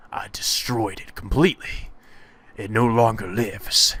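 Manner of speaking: whispery, slow, sinister gloating; menacing hush. Acted. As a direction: whisper it with dark satisfaction. Acted menace over destroyed chair.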